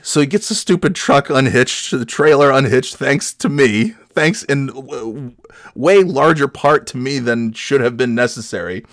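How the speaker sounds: ordinary talk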